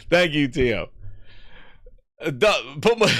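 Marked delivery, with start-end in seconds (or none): none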